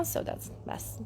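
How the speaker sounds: low breathy whisper; whispered teasing aside. Real, not acted. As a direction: drop it under your breath. Aside about husband watching.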